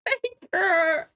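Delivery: high, drawn-out tearful wail; real crying. Real, not acted. Viewer mourning a favourite character, crying. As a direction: break into a grieving cry, stretching the words. Reacting to a beloved character's death.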